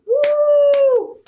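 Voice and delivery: high, loud imitated cheer from the audience at home; cheering woo. Imitating the viewers at home cheering, a loud high woo. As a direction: whoop like a crowd celebrating. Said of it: "Everyone at home's like".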